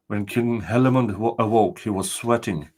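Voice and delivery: usual speaking voice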